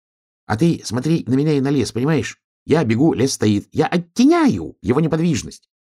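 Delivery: high, excitable hedgehog voice; insistent hedgehog. Acted. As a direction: explain urgently as a high-voiced little hedgehog.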